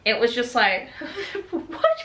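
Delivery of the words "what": incredulous laugh. Real, not acted. Disbelief at the giant angels.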